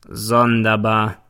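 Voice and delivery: slow, drawn-out smirk on the word; grinning, sardonic. A narrator's reading. Prosecutor mocks the girl's story, slow grinning sneer. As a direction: let the prosecutor savour his doubt. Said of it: "grinst der Staatsanwalt".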